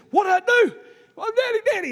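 put-on high, frantic child voice; screaming little boy. Preacher as stuck son pleading with daddy, mock screaming child. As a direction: imitate a kid having a fit. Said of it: "He's screaming, having a fit".

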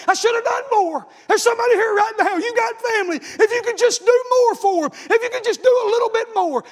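high, loud pleading cry from the pulpit; preacher at the peak of his plea; full-force preaching. Acted. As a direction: cry it out to the congregation, impassioned.

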